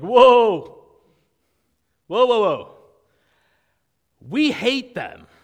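high, loud alarmed "whoa" shouts; alarmed protest. Retold or imitated. Disciples balking at Jesus sending them to Samaria, alarmed high shout. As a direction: recoil in shock and refuse outright.

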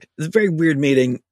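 ordinary talk, nothing marked

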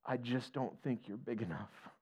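high, slow, tearful pleading to God; a frightened father weeping on the roof, begging God; weeping prayer. Retold or imitated. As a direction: cry it out to God, afraid for his kids. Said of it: "he's just weeping".

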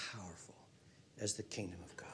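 hushed whisper, almost no voice. Acted. Preacher hushing his congregation in an awed whisper.

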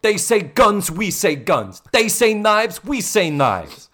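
put-on Brooklyn accent. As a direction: shout it like a Jets gang kid. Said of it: "With your Brooklyn accent".

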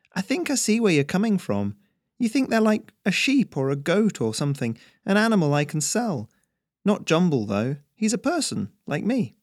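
put-on high-pitched young farmer voice; earnest farm boy. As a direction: explain patiently to a clueless stranger. Voices Harry explaining to stranger.

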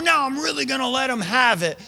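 aggressive. Retold or imitated. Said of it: "all of those are really aggressive".

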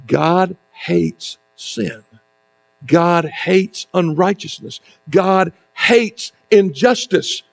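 very slow, each phrase weighed and hushed; slow solemn declaration. Real, not acted. The pastor tells the church what God hates, slow and grave. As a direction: lay down each truth with grave slowness.

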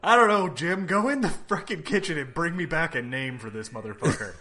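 loud, high-pitched fed-up order; exasperated boss. Acted. Acting out a fed-up comic-book namer.

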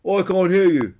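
high, loud claim not to hear; feigned deafness. A narrator's reading. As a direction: call it out to tease. Peter pretends not to hear.

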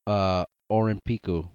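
Orimpiku with r, Japanese vowels; Japanese pronunciation. Shown as a demonstration. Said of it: "the Japanese word for Olympics is".

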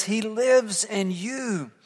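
slow, lightly voiced wise old baboon; Rafiki. Rafiki tells Simba his father lives on, slow sage voice. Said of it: "Rafiki says".

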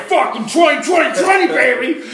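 high, loud and laughing, shouting the number; hyped gloat. Retold or imitated. To his buddies, retelling his triumph: high excited laughing gloat. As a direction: crow it like you just hit the jackpot.

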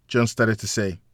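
ordinary delivery

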